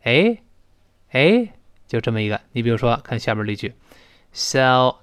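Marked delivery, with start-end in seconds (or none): 0.0-1.5 “哎”, pitched high to get someone's attention; 招呼人的“哎”. Shown as a demonstration. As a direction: call out a short high “哎” before a question. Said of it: "就特别类似于咱中国人说的". (用高音的“哎”招呼人)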